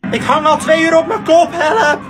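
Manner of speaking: calling for help. Acted. Stuck upside down, calls help.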